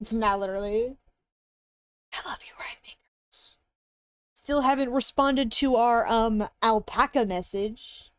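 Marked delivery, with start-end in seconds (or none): 2.1-3.6 soft unvoiced whisper. Real, not acted. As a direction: breathe it as a whisper. Whispered love.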